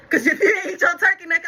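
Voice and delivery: laughing through the words, high and loud. Real, not acted. Laughing about the turkey necks.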